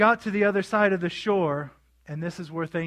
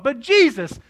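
higher, louder objection; mock protest; 6 semitones higher, 8 dB louder than usual. Disciples objecting to Jesus's order, mock protest. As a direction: push back like a protesting child.

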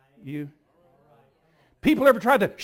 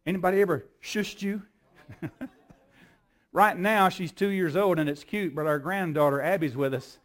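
usual speaking voice

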